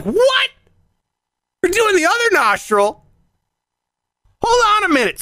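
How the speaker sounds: high-pitched, stunned disbelief; outraged double-take; acted incredulous protest. Acts out his alarmed objection.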